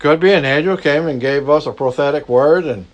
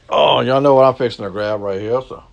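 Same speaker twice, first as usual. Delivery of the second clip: usual speaking voice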